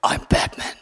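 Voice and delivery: a low, raspy, breathy growl of a line; Batman voice. Shown as a demonstration. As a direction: growl it like the caped crusader in the films. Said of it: "and I could do the voice".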